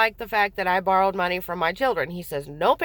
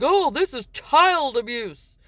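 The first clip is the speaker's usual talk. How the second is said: slow, higher-pitched mock accusation; accusing officer; 4 semitones higher, slower than usual. Cops seeing the handprint, mock outraged. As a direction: imitate an officer pronouncing a verdict.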